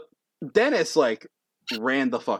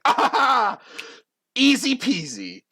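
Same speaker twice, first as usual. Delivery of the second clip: high, loud cackle of victory; triumphant crowing; 13 semitones higher, 6 dB louder than usual. Acted. Dex after lighting fire, high triumphant laugh. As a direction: crow smugly in a high voice.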